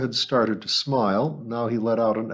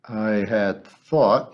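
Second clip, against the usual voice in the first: slow and deliberate, weighing each word; slowly; much slower than usual. Acted. Captain slowly explaining his plan to the old woman. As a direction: draw it out while thinking. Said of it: "he said slowly".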